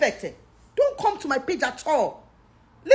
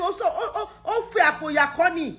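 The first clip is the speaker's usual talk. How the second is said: ordinary talk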